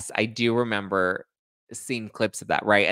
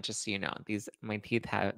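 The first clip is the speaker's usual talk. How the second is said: ordinary delivery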